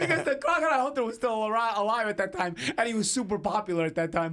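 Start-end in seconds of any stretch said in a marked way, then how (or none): 0.0-2.6 higher and louder, laughing through it; can't stop giggling as he explains. Real, not acted. Guest explains the knockoff to friends, laughing.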